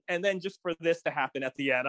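ordinary delivery

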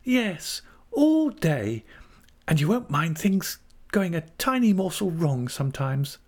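put-on high, girlish young wife, asking hopefully; Dora. A narrator's reading. Narrator plays Dora.

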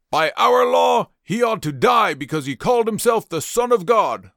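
insistent demand. Acted. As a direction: shout the law at Pilate. Leaders demand Jesus' death.

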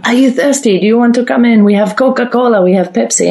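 imitated Russian-sounding host offering drinks; eager host, rough Russian accent; mock Russian accent. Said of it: "Sounded Russian".

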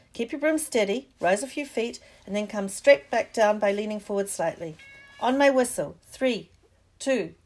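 Madam Hooch barks at her pupils; loud instructions. Acted. Madam Hooch calls out loud, fast instructions to the class.

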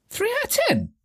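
very high, disbelieving squeak; incredulous. Real, not acted. Quiz player revealing a poor score, incredulous squeak.

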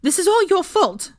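high, loud, furious accusation; angry outburst, voice shooting up; spat. A narrator's reading. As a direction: spit the blame out at full pitch. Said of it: "she spat".

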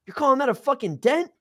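loud, high-pitched scoffing question; incredulous. Retold or imitated. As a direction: challenge it in high disbelief. Scoffs at the small dent.

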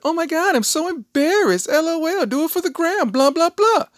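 mock squeal, very high and loud; hammed-up teen gushing for social media; embarrassed daughter. Acts out embarrassed daughter showing off.

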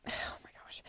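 breathy whispered aside; whispered sigh. Real, not acted. Overwhelmed aside mid sentence.

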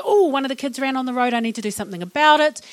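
loud, hurried burst of worry; mock alarm. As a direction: act out a sudden parental worry. Acts out a moment of anxiousness.

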